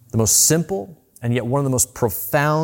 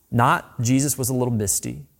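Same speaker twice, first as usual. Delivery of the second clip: ordinary talk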